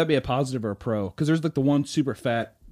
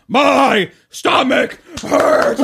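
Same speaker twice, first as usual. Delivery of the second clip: very slow high shouted wail; 9 semitones higher, 10 dB louder, much slower than usual. Pretend. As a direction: cry it out to the crowd. Stump speech climax, wailing.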